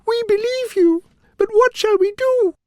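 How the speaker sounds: very high squeaky queen; tiny queen eager to help; squeaky earnest question; mouse queen. A narrator's reading.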